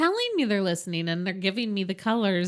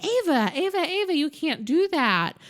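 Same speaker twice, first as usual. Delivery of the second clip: calling out; 9 semitones higher than usual. Retold or imitated. Parents stopping the child.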